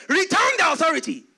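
high, loud imagined order; mock command. God telling the devil in Eden, loudly commanding. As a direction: boom out a command, playing God.

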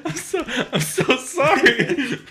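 high voice breaking with laughter; real, uncontrollable giggles, words dragged out; helpless laughter. Real, not acted. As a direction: apologise through a laughing fit. Apologising through helpless laughter.

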